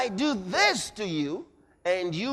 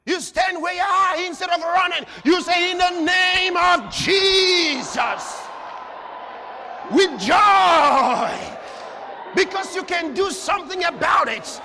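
loud, high shouting sermon; preacher shouting faith over the crowd; fiery preaching; 9 semitones higher, 7 dB louder than usual. Real, not acted. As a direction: thunder it at the congregation. Preaching at full force.

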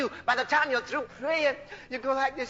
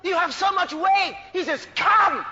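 high, stretched-out preacher's call; full-force preaching; 6 semitones higher, 5 dB louder than usual. Acted. Preacher voicing Jesus calling the burdened, high ringing call. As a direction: call the weary in, raised and slow.

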